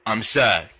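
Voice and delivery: very low, deflated sad voice; mock sad. T-Man mourning the snail, mock sad low voice. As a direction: say it low and glum. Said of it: "Team Man's sad now".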